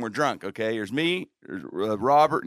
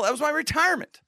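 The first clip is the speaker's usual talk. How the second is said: much higher, hammed-up lament; milk the loss for laughs; mock outrage. Real, not acted. To the hosts about the lost prop, mock lament.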